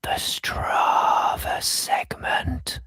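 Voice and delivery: long, drawn-out whispered announcement; stage whisper. Pretend. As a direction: whisper the title slowly like a jingle. Podcast segment title, stage-whispered.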